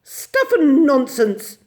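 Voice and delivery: loud, high, slow dismissal; indignant scoff; cross. A narrator's reading. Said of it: "said Miss Lark crossly".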